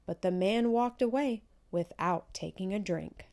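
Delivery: ordinary delivery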